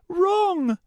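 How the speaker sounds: insist, not giving an inch; insistent high girl voice. Acted. Starbreeze refuses again.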